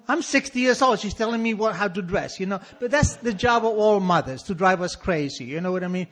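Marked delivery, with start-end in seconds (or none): none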